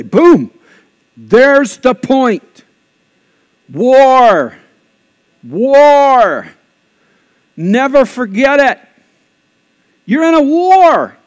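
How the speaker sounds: loud, high, drawn-out punch on each word; emphatic proclamation. Real, not acted. Pastor warns congregation they are in a war, emphatic proclamation. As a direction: hammer each short phrase out, high and loud. Preacher drives his point home.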